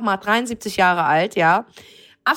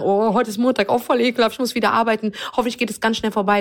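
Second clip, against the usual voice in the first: mock complaining. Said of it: "Oder zu sagen".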